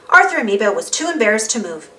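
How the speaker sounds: ordinary delivery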